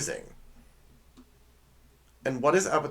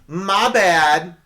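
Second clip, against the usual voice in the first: loud, higher mock apology; sarcastic; 5 semitones higher, 7 dB louder than usual. Shown as a demonstration. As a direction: call out a not-sorry apology. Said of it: "it's your sarcastic way of doing it".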